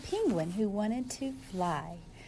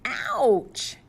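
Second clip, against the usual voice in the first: high, loud little yelp of pain; ouch cry; 12 semitones higher, 7 dB louder than usual. Acted. Penguin falling to the ground after spinning, high yelp. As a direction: yelp it as you hit the ground. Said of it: "cried Flip Flop".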